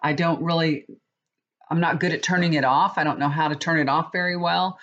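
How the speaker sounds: ordinary delivery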